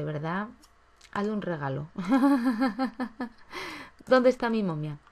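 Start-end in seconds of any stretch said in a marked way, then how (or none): 1.9-3.3 short high laugh at the screen; burst of laughter. Real, not acted. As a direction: laugh out loud at what you see. Laughing at the game.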